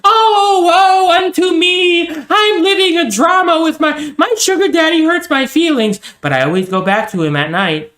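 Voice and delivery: high, sung melodramatic lament; grand tragic wailing. Retold or imitated. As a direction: wail it like a tragic heroine, hammed-up. Mocking melodramatic feminist lament.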